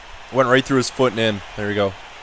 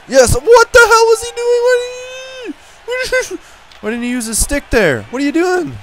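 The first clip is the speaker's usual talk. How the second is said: can't believe what he just did; incredulous high-pitched rant; exasperated disbelief; 6 dB louder than usual. Real, not acted. Exasperated at teammate's miss.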